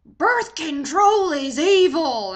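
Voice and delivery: loud, slow, drawn-out denunciation; radio preacher. Retold or imitated. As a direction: thunder it out like a hammed-up radio preacher. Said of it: "on the radio".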